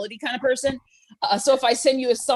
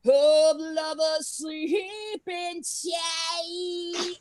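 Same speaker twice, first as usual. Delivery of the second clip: slow, drawn-out power-ballad line, lightly voiced; milk each word like a diva ballad; 6 semitones higher, 3 dB louder, much slower than usual. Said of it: "Here's my impression of [name]".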